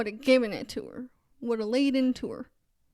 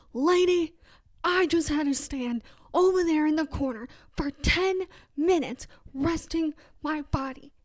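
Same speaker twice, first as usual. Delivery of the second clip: imagined blow-up; 4 semitones higher, 4 dB louder than usual. Pretend. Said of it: "to not blow up at her and go".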